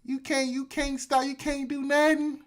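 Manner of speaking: begging officials. Acted. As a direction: beg like a desperate panhandler.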